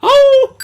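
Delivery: high, loud wolf howl. Pretend. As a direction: throw back your head and howl. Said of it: "I wanted to do a wolf howl".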